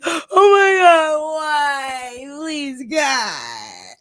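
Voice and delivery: high, loud, drawn-out wail to God; crying out to God. Retold or imitated. She cries out to God when hurt, wailing. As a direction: wail it like a desperate plea. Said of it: "I go running to Jesus".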